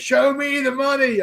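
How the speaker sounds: high, far-off movie-hero voice, a big hammed-up line; Jerry Maguire impression. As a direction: bellow it like the movie catchphrase. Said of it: "where he goes".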